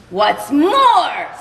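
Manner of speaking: a creature losing its temper; snapping Lorax. Acted. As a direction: snap the words out angrily. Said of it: "snapped the Lorax".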